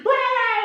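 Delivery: very high, drawn-out mock wail; acted cry of overwhelmed relief; mother's wail. Mother seeing her lost child return, acted wail.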